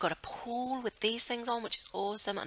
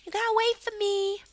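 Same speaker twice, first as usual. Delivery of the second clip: put-on much higher, squeaky little child calling; little girl voice; 3 dB louder than usual. Little Sophia calling after her family, high childlike voice. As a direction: play the tiny kid running to catch up. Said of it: "She's like".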